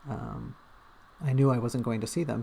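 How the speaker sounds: usual speaking voice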